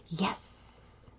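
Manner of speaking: a breathy, voiceless "Yes"; whispered yes. A narrator's reading. Boy finding the pencil he needed, whispered triumph. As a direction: whisper a private victory.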